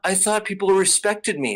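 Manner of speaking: put-on a baffled spoiled child; mock hurt noble. Mocking the noble's hurt surprise, whiny. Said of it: "was just like".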